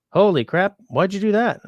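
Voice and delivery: real surprise at a generous viewer; shocked. Real, not acted. Shocked reaction to chat.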